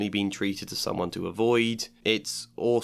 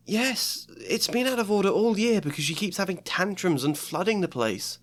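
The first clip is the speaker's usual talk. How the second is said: put-on higher girlish explaining voice; a bright girl explaining, pitched higher; schoolgirl Hermione; 8 semitones higher than usual.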